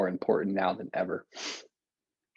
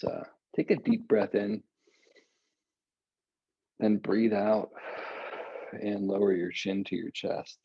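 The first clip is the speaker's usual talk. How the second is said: calm guided instruction. Acted. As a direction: guide the breathing calmly and gently. Guides a breathing stretch session.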